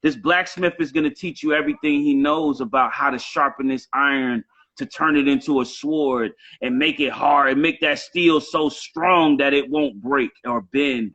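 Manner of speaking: ordinary talk, nothing marked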